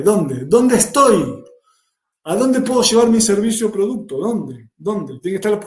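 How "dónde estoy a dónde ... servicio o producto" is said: usual speaking voice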